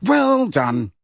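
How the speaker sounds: high, over-hearty congratulation; forced hearty. A narrator's reading. Boy congratulating his friend, falsely hearty. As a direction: overdo the cheer until it sounds false. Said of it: "so heartily it did not sound like his voice".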